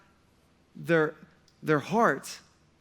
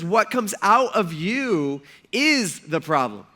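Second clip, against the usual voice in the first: slow, weighty, louder line; slow emphatic; 2 semitones higher, 4 dB louder, slower than usual. Retold or imitated. Jesus telling the crowd, slow and emphatic. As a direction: land the point word by word. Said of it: "He says".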